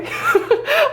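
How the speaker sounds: high giggle at herself; embarrassed laugh. Real, not acted. Vlogger laughing at her own mispronunciation, high giggle. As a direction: laugh off the slip.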